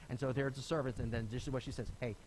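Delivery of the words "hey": ordinary talk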